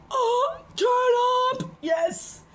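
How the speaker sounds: party whoop. Pretend. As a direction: whoop it out like the party just started. Party whoop after spraying.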